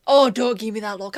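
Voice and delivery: ordinary delivery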